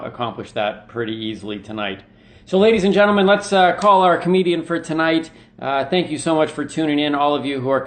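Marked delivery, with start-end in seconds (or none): 2.4-5.3 showman intro. Acted. Host introducing guest.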